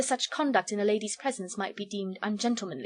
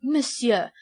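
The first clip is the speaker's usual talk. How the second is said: put-on louder, higher French-accented protest; French accent, taken aback; abashed protest; 4 semitones higher, 7 dB louder than usual. A narrator's reading. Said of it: "somewhat abashed".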